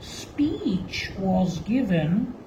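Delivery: slow, low, deliberate recitation with long pauses; measured classroom quotation; slow dictation. Shown as a demonstration. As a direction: dictate the epigram word by word. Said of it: "let us take an example of epigram".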